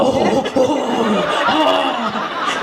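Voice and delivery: long drawn-out "oh" groans; slow mock-pained moans; sympathetic groans. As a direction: mimic a crowd wincing in sympathy. Imitates women's pained sympathy.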